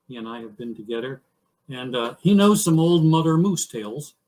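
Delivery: plain reading voice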